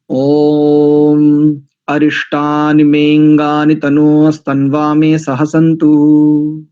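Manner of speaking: slow, steady chanted Sanskrit; solemn slow Vedic chant; mantra recitation. Shown as a demonstration. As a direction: recite each mantra evenly, drawn out. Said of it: "उच्चारण भी आपको करके दिखा देता हूं".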